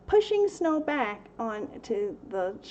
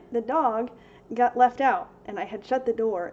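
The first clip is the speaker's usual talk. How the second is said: normal talking voice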